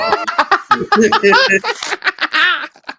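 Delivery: high, loud burst of laughter; helpless high-pitched laughter; real laugh. Real, not acted. Reacting to co-host's exaggerated plea.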